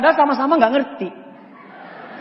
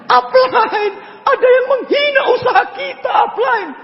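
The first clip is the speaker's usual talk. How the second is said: hammed-up tattling cry, much higher; mock whining to the boss. Newbie tattling to upline, hammed-up high wail.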